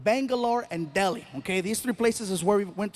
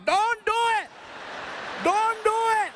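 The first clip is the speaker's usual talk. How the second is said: high insistent repeated warning; high pleading warning from a man standing up; pleading shout; 11 semitones higher than usual. Retold or imitated. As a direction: beg him not to. Crowd member pleading.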